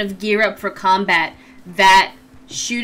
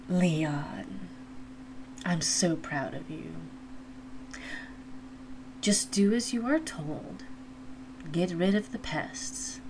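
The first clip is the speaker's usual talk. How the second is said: villain voice; 2 semitones lower, 13 dB quieter than usual. Acted. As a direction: purr it like a sinister queen.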